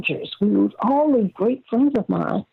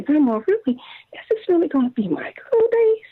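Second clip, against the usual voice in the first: granddaughter pleading; 8 semitones higher than usual. Retold or imitated. Granddaughter voiced, eyes watery.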